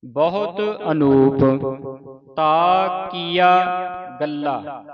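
slow, loud, ਗੱਲਾ with a tight doubled ਲ; heavy ਗੱਲਾ. Shown as a demonstration. Teacher drills the heavy ਗੱਲਾ for students. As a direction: press ਗੱਲਾ hard, geminate the ਲ. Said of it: "ਕੱਸ ਕੇ ਭਾਰਾ ਬੋਲਣਾ ਇਹਨੂੰ".

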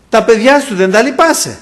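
high, loud accusing question; Satan's reproach. Retold or imitated. Preacher voices Satan using his children, loudly reproachful. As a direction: press on his conscience as the tempter. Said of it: "άκουγα τη φωνή του".

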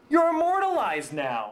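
high-pitched insistence; exasperated protest. Acted. Writer telling his ex to be grateful, exasperated.

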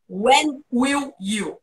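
very slow, syllable by syllable; slow drilled demo. Shown as a demonstration. Teacher repeats the model question very slowly. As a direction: stretch every sound for the learner. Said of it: "mete o will e mais um I U".

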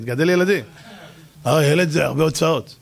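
ordinary talk, nothing marked

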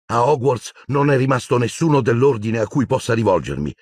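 ordinary delivery

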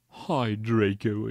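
slow, gloomy drawn-out greeting; hammed-up dejected drawl; depressed voice. A narrator's reading. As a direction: say hello as if deeply depressed. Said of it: "said in a depressed voice".

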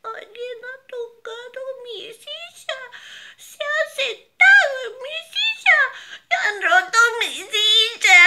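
put-on baby bear crying. Baby bear cries over his broken chair, high wail growing loud. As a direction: wail it like a heartbroken cub. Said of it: "dijo llorando con voz suave".

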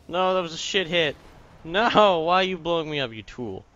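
high, loud protest at teammate; angry shout. Real, not acted. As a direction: yell in outrage. Teammate hits him badly.

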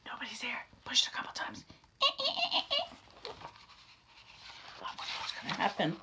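soft sneaky whisper. Acted. Larry sneaking another push, whispered. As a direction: hush it secretly.

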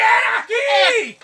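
high, drawn-out cry; alarmed insistence. Acted. Frightened digger insists to doubting mates, crying out high. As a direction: insist in fright, stretching the words. Insists apparition was there.

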